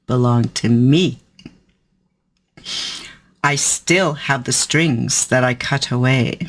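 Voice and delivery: ominous spoken-word reading; dramatic poem reading. Acted. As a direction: perform it like a sinister spellcaster. Reads poem Doll Spell.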